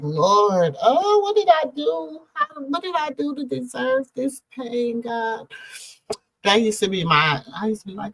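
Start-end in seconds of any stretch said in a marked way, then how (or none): 0.0-5.5 high, pained cry to the Lord, pitched up; anguished plea to God. Retold or imitated. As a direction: plead upward as the pain buckles your knees. Retells crying out to God in pain.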